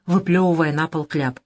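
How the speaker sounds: ordinary delivery, nothing marked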